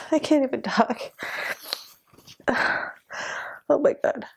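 choked up, halting between heavy sighs; crying. Real, not acted. Viewer tells audience of a beloved character's death, crying. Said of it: "I feel stupid for crying this much".

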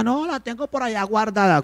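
put-on high, soft excuse of a street kid; imitating an evasive beggar. Preacher voicing the homeless boy dodging a question, high and soft. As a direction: mumble the excuse like you sold it.

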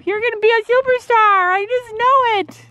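very high gushing pet voice. Real, not acted. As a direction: gush over the pony. Praising the horse.

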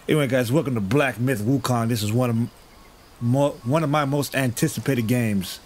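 ordinary talk

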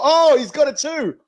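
high, loud, excited exclamation; thrilled, high-pitched "oh"; excited discovery. Real, not acted. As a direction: whoop it out as you spot it, pitch leaping up. Spotting the mask on the label.

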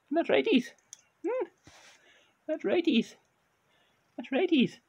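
high sing-song praise for the cat; high pet-talk voice. Shown as a demonstration. Owner praising his cat in a high coo. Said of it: "you use the tone of your voice".

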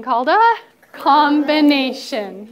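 loud, stretched-out "combination"; emphatic class prompt. Shown as a demonstration. Repeats it for class to echo.

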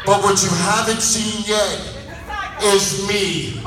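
normal talking voice

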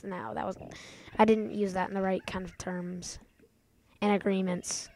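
soft whisper, talking to himself; whispered self-correction. Real, not acted. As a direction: mutter the correction under breath.

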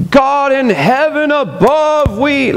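high, slow pompous prayer voice; mock Pharisee praying aloud; showy street prayer. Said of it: "how the Pharisees would be".

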